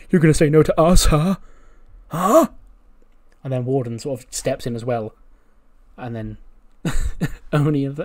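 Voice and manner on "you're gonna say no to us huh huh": high, loud mock yell right in his face; imitating an in-your-face scream. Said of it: "starts screaming".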